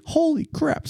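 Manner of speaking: retold panic in a small high voice; alarmed exclamation. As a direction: squeak it out in mock terror. Reacting to speeding in retold chase.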